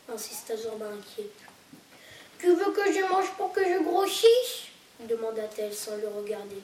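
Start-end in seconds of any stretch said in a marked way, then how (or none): 2.4-4.7 high, louder hurt little seagull; young gull voice. Acted. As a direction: voice the sulking young gull, well above your own. Young gull asks the cat.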